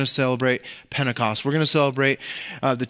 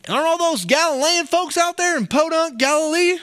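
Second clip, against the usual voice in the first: bad Southern accent; 12 semitones higher than usual. Preacher mocks the Galileans as Podunk folk, in a bad Southern accent. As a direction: put on a high, corny Southern accent. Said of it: "I was a horrible Southern accent".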